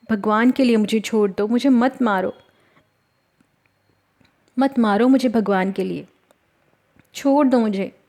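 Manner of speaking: acted begging for mercy; pleading. A narrator's reading. Beaten girl begs the wrestlers to stop, pleading. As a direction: plead with captors, a touch of desperation. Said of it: "Lakshmi हाथ जोड़कर गिड़गिड़ाने लगी".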